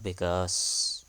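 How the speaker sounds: usual speaking voice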